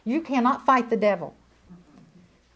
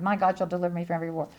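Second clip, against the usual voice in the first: rapid repetition; 6 semitones lower, 5 dB quieter, much faster than usual. Retold or imitated. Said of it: "I bet I said it a thousand times".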